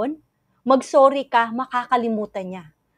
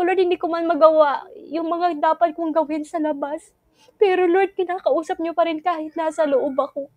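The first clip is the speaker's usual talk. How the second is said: praying through held-back tears; tearful prayer; 3 semitones higher than usual. Real, not acted. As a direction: pray while fighting tears. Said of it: "naiiyak ako". (umiiyak nang pigil habang nagdarasal)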